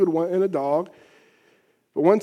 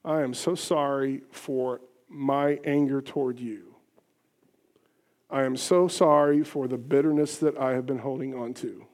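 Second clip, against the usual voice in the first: softer, lower, heartfelt confession; humble sincere apology; 5 semitones lower, 5 dB quieter than usual. Shown as a demonstration. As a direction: soften your voice, own every fault. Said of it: "approach the conflict like that".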